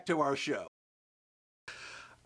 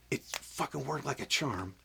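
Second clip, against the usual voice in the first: usual speaking voice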